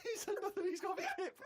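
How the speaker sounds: high, laughing words; laughing hard. Real, not acted. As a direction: gasp it out mid-laugh. Laughing at Karl's diary.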